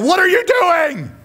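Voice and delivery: high, slow yell at the screen; a fan's exasperated high-pitched shout. Retold or imitated. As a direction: howl it at the Cowboys on TV. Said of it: "three sayings that I yell at the T V".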